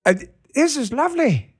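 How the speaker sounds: mock British accent, pitched high; bad UK accent. Host echoing the kid from the clip, bad UK accent. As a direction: mimic a British kid, UK accent not quite landing. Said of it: "I don't do a good U K accent".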